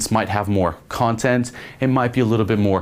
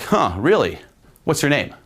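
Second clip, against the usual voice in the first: challenging push; 6 semitones higher than usual. Shown as a demonstration. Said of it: "a little bit more of, like, a push".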